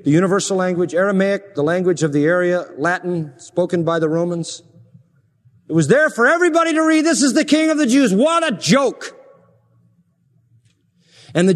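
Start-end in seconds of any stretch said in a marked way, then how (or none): 5.7-9.2 rising sarcastic preaching. Real, not acted. Preacher scorns the sign before the congregation, higher and mocking. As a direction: lift it high and mock the sign.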